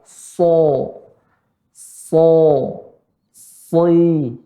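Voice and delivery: heavy drawn-out "So, soi"; thick heavy S. Shown as a demonstration. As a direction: stretch "So, so, soi", dark and rounded. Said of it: "Dia huruf tebal".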